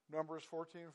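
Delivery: ordinary talk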